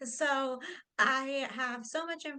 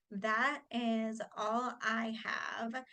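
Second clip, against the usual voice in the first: ordinary talk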